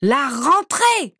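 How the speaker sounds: high, loud and slow bark. Acted. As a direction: bark it at her. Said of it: "aboya Bellatrix".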